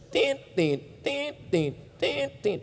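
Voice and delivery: soft, clipped hospital-monitor beeps "Tit, tit"; short beeps. Retold or imitated. Preacher imitates a coma patient's monitor with soft, short "tit" beeps. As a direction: chop each "tit" short like a monitor still beeping. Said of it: "Koma itu bunyinya belum panjang, masih ada putus-putusnya".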